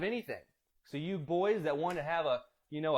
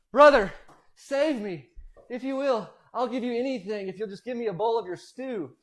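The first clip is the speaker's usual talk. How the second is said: loud, higher dramatic plea; desperate begging; 6 semitones higher, 8 dB louder than usual. Acted. Starving Esau begging Jacob for stew, desperate plea. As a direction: cry out in hammed-up hunger. Famished Esau begs his brother.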